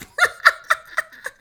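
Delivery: high squealing laugh. Real, not acted. Laughing after impression.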